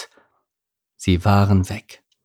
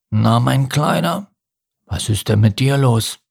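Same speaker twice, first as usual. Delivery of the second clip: deep fish voice; 3 semitones higher than usual. Acted. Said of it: "eine tiefe Stimme".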